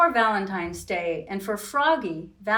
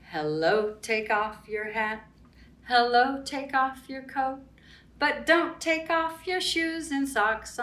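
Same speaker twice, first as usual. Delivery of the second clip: playful rhyme; 4 semitones higher than usual. Acted. Chanted greeting rhyme.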